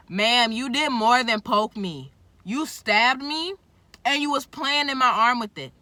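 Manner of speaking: loud exasperated retort; indignant. Real, not acted. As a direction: scold the nurse for stabbing her. Loud indignant address to the nurse.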